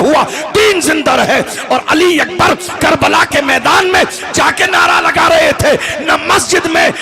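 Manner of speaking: high, loud, shouted oration; fiery shouted sermon; preaching at full force. Acted. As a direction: cry it out like a battle call. Fiery speech, shouted quotation.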